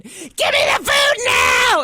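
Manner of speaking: mock demonic wrestling-style roar; high, loud, drawn-out demanding shout; possessed kid. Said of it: "You must have scared your parents".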